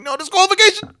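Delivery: high-pitched imitation of a squeezed-in interjection; high, squeezed commentator impression; Taz. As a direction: mimic a commentator cutting in for the last word. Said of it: "Taz squeezing in to get the last word, muttering".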